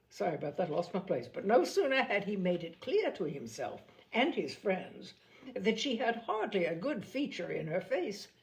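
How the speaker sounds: usual speaking voice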